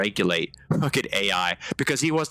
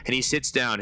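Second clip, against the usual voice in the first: normal talking voice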